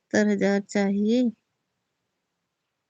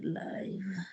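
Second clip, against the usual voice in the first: quick whispered aside; 18 dB quieter, much slower than usual. Real, not acted. Whispering to herself mid live stream. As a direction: whisper it so the stream can't hear.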